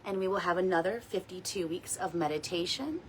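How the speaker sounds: soft, unhurried meditation guide voice; gentle guided meditation read. Acted. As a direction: ease the listeners into calm. Leads a weekly guided meditation.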